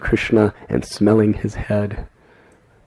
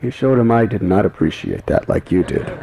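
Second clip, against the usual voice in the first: usual speaking voice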